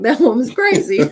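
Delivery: high, loud mock neighbor voice. Neighbors judging her for screaming outside, high loud mock. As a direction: squeal it like a scandalized neighbor. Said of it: "The neighbors are probably like".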